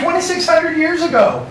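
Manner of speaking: usual speaking voice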